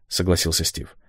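plain reading voice

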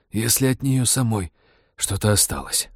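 wheezing, hoarse rasp. A narrator's reading. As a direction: croak it out hoarsely. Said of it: "прохрипел Роджер".

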